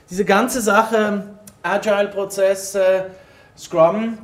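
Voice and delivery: usual speaking voice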